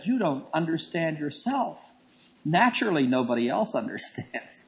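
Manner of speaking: soft, sweet retold explanation; very calm. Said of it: "then very calmly he said".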